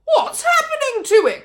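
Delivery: high, loud, laughing cry of alarm; excited cry. A narrator's reading. Mister Cranky watching the legs grow, laughing cry. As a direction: blurt it out half laughing. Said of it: "cried Mister Cranky".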